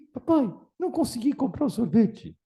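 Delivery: put-on high, let-down little boy voice; a kid reporting failure to his dad; disappointed child. Boy tells father he couldn't buy ice cream, high childlike.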